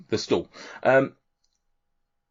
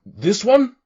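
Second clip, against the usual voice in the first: lightly voiced cheery half-orc in broken Common; a hint of a jolly orc bull-handler; 11 semitones higher, 8 dB louder than usual. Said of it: "another half orc".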